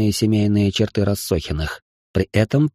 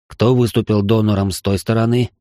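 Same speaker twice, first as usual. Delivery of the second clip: ordinary delivery, nothing marked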